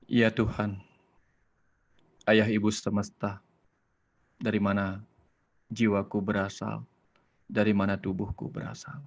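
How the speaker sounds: hushed, unhurried prayer leader; guided prayer. Acted. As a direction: settle the listeners into silence, then pray. Leads a prayer and meditation.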